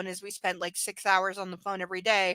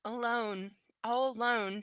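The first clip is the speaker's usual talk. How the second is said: murmured; 7 dB quieter, slower than usual. A narrator's reading. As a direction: murmur it, barely voiced, to yourself. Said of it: "she murmurs".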